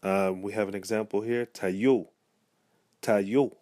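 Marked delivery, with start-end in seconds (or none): 1.5-2.1 "tayo" as ta-YO, stress on the end; "tayo" with a stressed final YO; stress on final syllable. Shown as a demonstration. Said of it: "O is the same with the acute accent". 3.0-3.6 "tayo" as ta-YO, stress on the end; "tayo" with a stressed final YO; stress on final syllable. Shown as a demonstration. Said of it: "O is the same with the acute accent".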